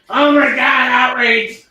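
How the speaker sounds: high, loud mock-outraged cry; hammed-up shocked outburst; mock outrage. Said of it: "Somebody just, like, looked at the beginning".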